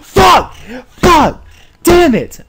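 loud, high angry yell; real raging outburst; furious shout. Real, not acted. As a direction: explode in frustration. Failed to catch Diancie.

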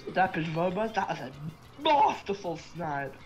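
ordinary talk

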